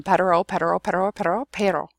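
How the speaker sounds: "pero" from pedder-oh, run together into a tap; fast repetition drill. Shown as a demonstration. Teacher demonstrating the exercise, "pero" repeated fast. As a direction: chain "pero" rapidly until the R taps. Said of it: "over and over again as fast".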